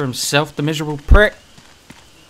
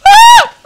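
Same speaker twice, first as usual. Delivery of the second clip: high shriek; 16 dB louder than usual. Real, not acted. Jump scare.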